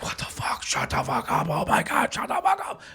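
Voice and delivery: panicked whispered yelling; whispered plea from someone hiding; frantic whispering. Retold or imitated. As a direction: hiss it frantically under your breath. Hiding, begging honking to stop.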